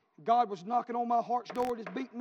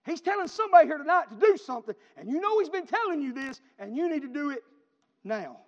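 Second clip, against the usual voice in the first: louder, higher preacher's appeal; preaching at full force; 5 semitones higher, 9 dB louder than usual. Real, not acted. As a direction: press the call on the listeners.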